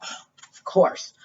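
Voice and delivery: ordinary delivery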